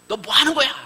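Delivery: mother's mock angry scolding; scolding shout. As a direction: yell at the lost boy. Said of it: "이러면서 호통을 쳤을 겁니다".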